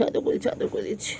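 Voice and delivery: whispered cuddling words; whispered comfort to a cat; whispered soothing. Real, not acted.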